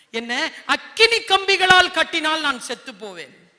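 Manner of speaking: loud proud declaration; bold boast. Acted. Samson tells Delilah his fake secret, loudly proud. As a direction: boom it out like a mighty man.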